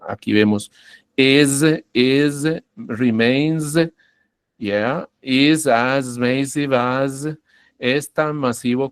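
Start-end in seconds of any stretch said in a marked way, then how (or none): 1.2-3.8 "is, is, remains" each with a buzzing final z; Z endings. Shown as a demonstration. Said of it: "se pronuncia como una Z". 5.2-7.3 "is as massive as" slowly, "is" and "as" buzzing with z; Z endings. Shown as a demonstration. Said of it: "se pronuncia como una Z".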